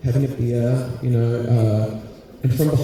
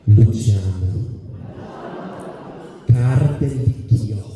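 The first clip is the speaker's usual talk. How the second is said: slow, rolled Italian-accented Luciano Cardelicchio; 3 semitones lower, much slower than usual. Shown as a demonstration. Comic proudly saying his Italian husband's name, slow and savoured. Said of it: "his name is".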